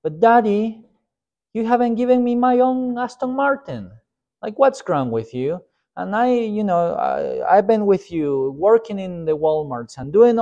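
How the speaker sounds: high, whiny spoiled-brat voice; spoiled son griping at his father, high and whiny; spoiled rich son. Retold or imitated. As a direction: pout and whine about the unfair father. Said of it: "Walton's son".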